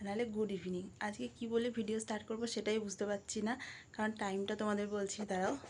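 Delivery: hushed late-night voice; speaking softly. Real, not acted. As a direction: keep it soft so nobody wakes. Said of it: "আর এত আস্তে আস্তে কথা বলছি". (আস্তে আস্তে চাপা গলায়)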